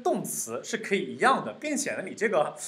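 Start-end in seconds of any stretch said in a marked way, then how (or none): none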